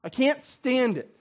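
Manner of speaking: [name] voiced, fierce and indignant; [name]'s outrage. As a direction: spit out the disgust. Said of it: "[name] says".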